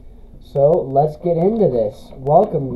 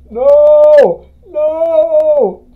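high loud cry of no; anguished scream; 9 semitones higher, 10 dB louder than usual. Acted. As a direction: scream it out in panic. Character crying out in game.

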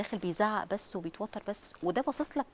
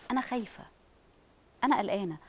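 calm honest worry. Shown as a demonstration. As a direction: tell the kids you're worried without alarming them. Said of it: "قولي لهم".

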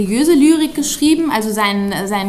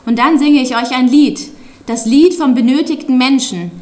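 declaimed poem, firmer and louder than chat; poem recitation; 4 dB louder than usual. Acted. Child reads out father's protest poem to an audience, declaimed. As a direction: recite it line by line, emphatic, with deliberate breaks.

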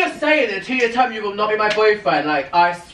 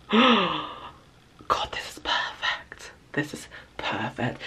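whisper; 3 semitones lower, 7 dB quieter, slower than usual. Real, not acted. Gloating aside to camera.

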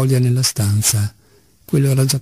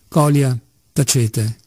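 ordinary delivery, nothing marked

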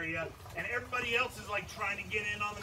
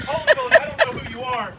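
high, loud startled cry; startled outburst; 13 semitones higher, 9 dB louder than usual. Real, not acted. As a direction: caught off guard by a lunging gator. Gator rushes at him.

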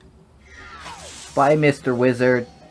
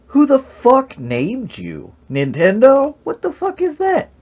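incredulous; 4 dB louder than usual. Real, not acted. Mocking a character's name.

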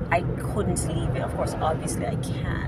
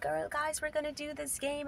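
high, hushed, gushing teacher voice; bubbly fun teacher; 8 semitones higher, 10 dB quieter than usual. Pretend. Teacher mocking a fun teacher, high and gushing. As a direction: play the peppy game-loving teacher. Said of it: "I'm not like".

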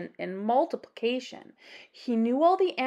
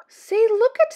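much higher, louder cry; excited call; 10 semitones higher, 8 dB louder than usual. A narrator's reading. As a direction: point it out eagerly. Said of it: "said Maisie".

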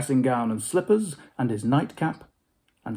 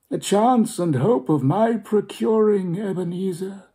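higher, louder grave spectral warning; Marley's ghost warning Scrooge; solemn ghost; 7 semitones higher, 4 dB louder than usual. Acted. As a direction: warn him gravely.

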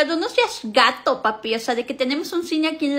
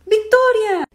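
loud call across the house; mother calling daughter; 7 semitones higher, 4 dB louder, much slower than usual. Acted. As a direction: call her name sharply.

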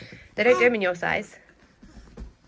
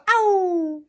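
high, loud cartoon ow; squeaky mock yelp; mock ow for the dog; 8 semitones higher, 7 dB louder than usual. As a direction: put words in the dog's mouth.

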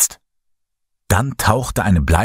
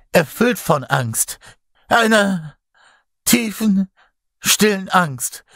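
high, halting anguished confession; from the soul; 9 semitones higher than usual. A narrator's reading. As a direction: draw it out word by word, pitched high. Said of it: "aus der Tiefe seiner Seele".

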